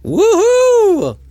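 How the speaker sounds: high whooping cheer. Real, not acted. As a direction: whoop loudly in delight. Cheering the limerick.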